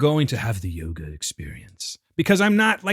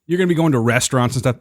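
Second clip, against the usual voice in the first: ordinary talk, nothing marked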